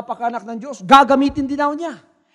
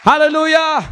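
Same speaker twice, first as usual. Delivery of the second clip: loud hallelujah; 5 semitones higher, 7 dB louder than usual. Real, not acted. Preacher's exclamation.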